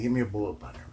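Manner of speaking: whispered request. Retold or imitated. Asking a seller at swap meet.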